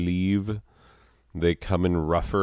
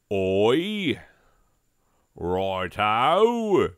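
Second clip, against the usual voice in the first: higher, slow hammed-up British accent; silly British accent; 6 semitones higher, 3 dB louder, slower than usual. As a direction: prance through every British cliché. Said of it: "all of the accents".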